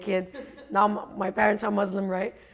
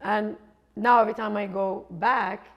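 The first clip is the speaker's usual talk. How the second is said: ordinary talk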